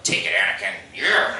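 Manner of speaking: mock-tough gamer taunt; geeky victory jeer; nerd trash talk. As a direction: taunt your opponent, rising on the last word. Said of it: "my nerd shit talk".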